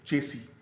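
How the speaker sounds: चेसी as CHE-see; correct pronunciation. Shown as a demonstration. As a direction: drop the last s in चेसी. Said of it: "इसका असल तलफ़ुज़ है". (चेसी, आख़िर में लंबी "ई")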